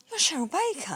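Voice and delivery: high-pitched, incredulous question; retold surprise. Customer spots a celebrity working the till, retold surprise. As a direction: imitate someone astonished to recognize her.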